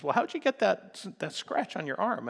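a touch of a worried mom asking; concerned mother. Retold or imitated. Mother asking her hurt daughter about the scratch, mildly concerned.

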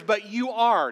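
"are" punched loud and falling; stress on ARE. Shown as a demonstration. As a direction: lean hard on the word "are". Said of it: "notice that".

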